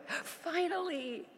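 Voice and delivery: high, slow, drawn-out relieved cheer; excited cry. Retold or imitated. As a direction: stretch it out, soaring with relief. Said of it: "I went".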